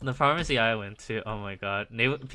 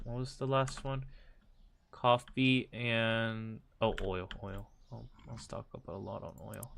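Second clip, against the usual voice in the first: mumbled to self; 6 dB quieter than usual. Real, not acted. Quiet play commentary.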